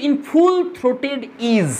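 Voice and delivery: very slow, full drawn out recital; slow poem reading. Shown as a demonstration. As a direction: let the words swell slowly like a singing bird. Teacher recites poem line. (খুব ধীরে গলা ছেড়ে কবিতা পাঠ)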